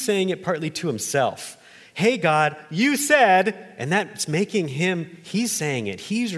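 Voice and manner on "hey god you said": higher and louder, calling out to God; pleading call to God. Acted. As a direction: cry out to God, holding him to his word. Jacob crying out to God.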